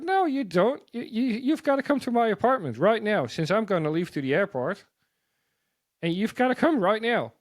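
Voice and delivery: high-pitched, insistent push, not taking no for an answer; pushy. Retold or imitated. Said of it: "he was pretty pushy".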